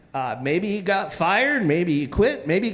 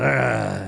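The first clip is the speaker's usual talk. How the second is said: growl; 10 semitones lower than usual. Retold or imitated. Soggy coach eyeing the kid, low growl. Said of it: "and he goes".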